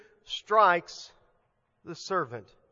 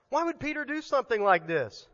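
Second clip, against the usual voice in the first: normal talking voice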